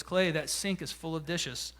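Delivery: low, soft statement that is really an order; hinting assignment. Retold or imitated. Wife tells husband about the dishes, quietly pointed imitation. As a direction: imitate a wife's quietly loaded remark. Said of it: "but it's really an assignment".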